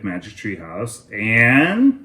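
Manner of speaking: ordinary delivery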